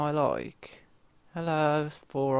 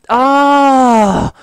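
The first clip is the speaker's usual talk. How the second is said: very high, very loud scream of panic; real scream; 11 semitones higher, 16 dB louder than usual. Real, not acted. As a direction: scream as you get caught. Being killed by the chicken.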